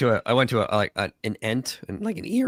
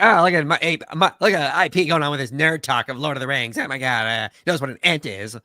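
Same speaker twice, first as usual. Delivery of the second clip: absent friend's reaction; 5 semitones higher, 4 dB louder than usual. Retold or imitated. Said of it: "he would have been like".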